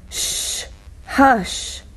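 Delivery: soft unvoiced shush to quiet the class. Shown as a demonstration. Teacher quieting a noisy class with a soft shush. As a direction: hush the room with a breathy shh. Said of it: "Gestion de la classe".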